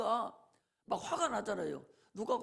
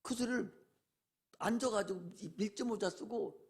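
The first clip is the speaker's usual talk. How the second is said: ordinary talk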